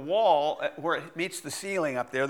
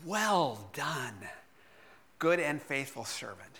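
solemn blessing; 3 semitones lower, 6 dB quieter than usual. Retold or imitated. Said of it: "the God Almighty say".

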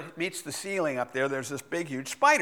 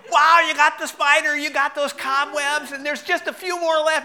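high, loud excited praise; imitating an excited cheering wife; 10 semitones higher, 8 dB louder than usual. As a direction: cheer him on like a proud fan. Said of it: "She's going".

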